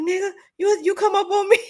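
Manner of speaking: high, half-laughing threat at the other car; laughing mock yell; mock road rage. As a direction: ham up the angry gunman. Road rage driver with gun.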